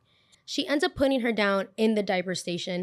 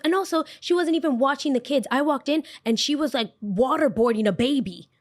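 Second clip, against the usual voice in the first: high-pitched mock indignant complaint; outraged tattling; 6 semitones higher, 4 dB louder than usual. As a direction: imitate a mother reporting a shocking offence. Mimicking mother's outraged complaint to owner.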